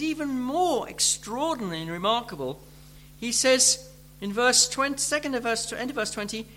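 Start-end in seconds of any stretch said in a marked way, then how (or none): none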